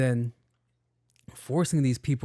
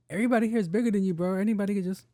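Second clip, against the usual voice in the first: higher, very fast ribbing; excited teasing; 8 semitones higher, much faster than usual. Retold or imitated. Teasing the skinny door guy, higher and very fast. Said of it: "And I'm like".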